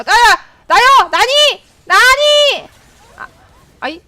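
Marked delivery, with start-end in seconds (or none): 0.0-2.7 high, loud sing-song calls; playful calling out. Real, not acted. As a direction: call out cheerfully, voice bouncing up. (들뜬 외침)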